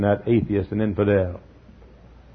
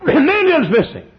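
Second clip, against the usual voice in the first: even higher and louder, with a laugh in it; heightened headline cry; 4 dB louder than usual. Acted. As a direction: repeat it with escalating astonishment.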